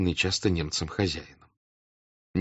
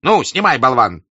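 loud, high angry bark; angry shout; 6 semitones higher, 9 dB louder than usual. A narrator's reading. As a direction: snap at the servant. Denisov angrily orders servant.

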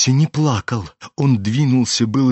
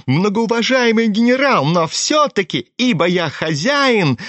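high-pitched, wheedling bluster; drunken self-important protest, high voice; tipsy, insolent; 7 semitones higher than usual. A narrator's reading. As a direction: argue stubbornly as master of the house. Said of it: "хмельной и озлобленный до нахальства".

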